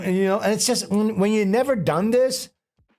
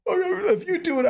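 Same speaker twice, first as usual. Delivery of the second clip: much higher imitated reluctant voice; wavering giving-in; 13 semitones higher than usual. Friend caving to the mushroom dare, wavering giving-in. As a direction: play a friend caving, pitched right up.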